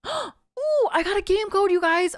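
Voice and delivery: high, thrilled squeal; excited gasp. Real, not acted. Streamer to viewers, squealing about a new code. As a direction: gasp and gush over the find.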